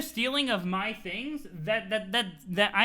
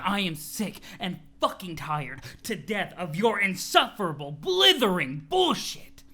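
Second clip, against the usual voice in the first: furious rant; 2 semitones lower, slower than usual. Acted. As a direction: spit out each insult. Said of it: "you seem really worked up".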